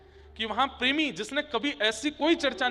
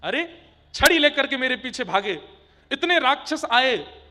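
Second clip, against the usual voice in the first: aggrieved outburst; 3 semitones higher, 7 dB louder than usual. Acted. Thakur ji recounts his hardships.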